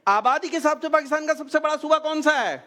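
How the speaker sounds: high, ringing call of the verdict; quiz show announcer. Real, not acted. Host confirming a child's answer, announcer style. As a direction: announce it big to the audience.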